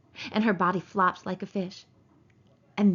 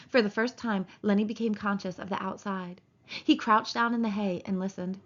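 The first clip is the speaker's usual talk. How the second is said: usual speaking voice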